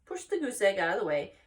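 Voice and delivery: plain reading voice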